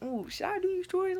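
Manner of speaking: high, soft self-questioning; musing to herself. Retold or imitated. Daughter debates doing chores, high soft musing. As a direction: wonder it in a little high voice.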